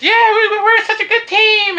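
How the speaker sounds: mock her in a high giggly voice; girly voice. The Japanese girl agreeing they're a good team, in a high squeaky voice. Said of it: "she's like".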